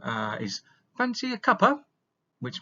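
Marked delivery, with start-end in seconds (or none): 1.0-1.9 high, loud, chirpy "Fancy a cuppa?"; offering tea. Shown as a demonstration. Teacher acting a British tea offer, high and loud. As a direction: offer a friend tea brightly. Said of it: "special sayings".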